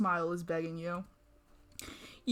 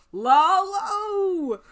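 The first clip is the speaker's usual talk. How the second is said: loud, much higher call of "Lalo!"; anguished cry; 10 semitones higher, 8 dB louder than usual. Real, not acted. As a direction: cry out his name in mock despair. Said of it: "cursing the writer by name".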